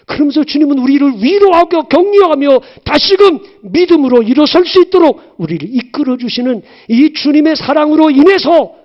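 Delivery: high, loud impassioned preaching; rising fervent sermon voice; preaching at full force. Acted. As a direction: cry it out from the pulpit.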